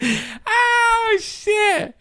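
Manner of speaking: high, breaking-up laugh through the words; can't stop laughing as you say it; laughing helplessly. Real, not acted. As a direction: squeal it out mid-giggle fit. Cracking up, losing composure.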